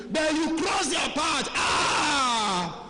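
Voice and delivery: high, slow shout of warning with a cry. Real, not acted. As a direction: cry out to scare them off the path. Preacher warning about demonic elders.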